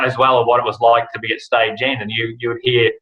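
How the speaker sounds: normal talking voice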